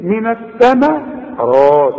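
«من الثمرات» ببطء بميم رقيقة وراء مفخمة; رقق «الثما» وفخم راء «الثمرات»; الراء وحدها مفخمة. Shown as a demonstration. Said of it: "الراء هي المفخمة". («الثمرات» ببطء، التفخيم في الراء فقط)

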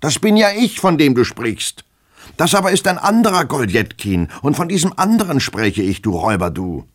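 shrieking, high and loud in rage; furious high shout. A narrator's reading. Master screaming at his servant, beside himself with rage. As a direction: scream it, losing all patience. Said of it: "schrie ihn unser Held an".

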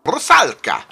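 a stab at a Russian accent on Rusalka; attempted Russian accent. Shown as a demonstration. Announcing the title in a mock Russian accent. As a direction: Rusalka said with a would-be Russian accent, loud and falling. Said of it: "I just wanted to do it in an accent".

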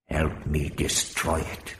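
low, slow, hushed warrior; a deep, careful alien voice; low Hrostar voice. Acted. Hrostar answers Barbara.

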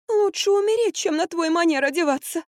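very high, dramatic wail; moaning. A narrator's reading. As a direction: moan in theatrical suffering. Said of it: "простонала Марфа". (высокий страдальческий стон)

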